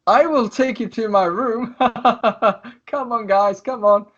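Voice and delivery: high, theatrical proclamation with a put-on laugh; showy rogue herding his guests; Lucius, public announcement. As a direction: announce it high and slow, then laugh and urge them along.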